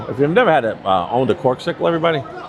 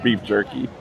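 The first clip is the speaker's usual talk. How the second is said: New York accent; 3 semitones lower, 3 dB quieter, slower than usual. Shown as a demonstration. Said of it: "with my New York accent".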